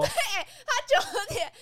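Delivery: laughing through the words, high and slow; can't stop giggling at the joke. Real, not acted. Laughing at the co-host's time-zone quip.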